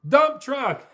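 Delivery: loud. Acted. Announcing next truck.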